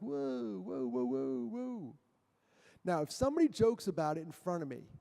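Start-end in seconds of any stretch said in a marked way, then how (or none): 0.0-1.9 mock alarm. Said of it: "they kinda joke about it".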